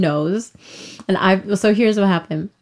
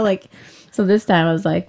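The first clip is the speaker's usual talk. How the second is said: ordinary talk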